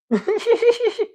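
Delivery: sudden high giggling laugh; tired, giddy high laugh; high giggle. Real, not acted. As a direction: burst into a squeaky giggle.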